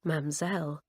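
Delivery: ordinary delivery, nothing marked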